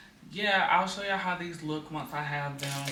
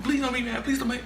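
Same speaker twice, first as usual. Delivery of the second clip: high, whiny imitation of a pleading child; 9 semitones higher, faster than usual. Daughter begging mom not to be mad, whiny child voice. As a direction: mimic the kid begging not to be in trouble. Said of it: "when I asked her what happened".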